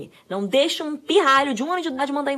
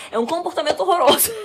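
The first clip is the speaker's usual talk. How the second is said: laughing; 7 semitones higher, 5 dB louder than usual. Real, not acted. Jokingly exaggerates child's behavior.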